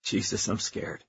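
tiny frightened near-whisper; scared whisper. Acted. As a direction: barely voice the fear. Trapped, admits fear.

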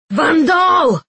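high, loud panicked shout; bellow. A narrator's reading. Giels calling his second guard, bellowing. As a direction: yell the name at full voice. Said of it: "then bellowed".

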